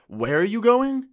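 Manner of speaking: high, loud rising demand; mock an indignant girlfriend's challenge; imitating angry girlfriend. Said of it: "she walks over angrily".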